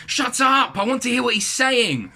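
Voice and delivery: loud, high-pitched acted snap at someone; frustrated outburst. A narrator's reading. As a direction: shout them down in frustration.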